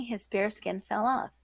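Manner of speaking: ordinary delivery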